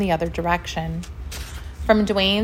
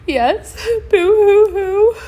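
hammed-up high, loud bawling; crying; 13 semitones higher, 8 dB louder, much slower than usual. A narrator's reading. Dwayne crying with paws over his eyes, hammed-up bawling. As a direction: bawl it out, storybook style. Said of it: "while he continued to cry".

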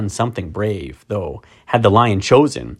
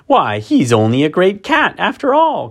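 high, mocking cry; jeering creature; 9 semitones higher than usual. A narrator's reading. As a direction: sneer like a gleeful monster. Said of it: "cried one".

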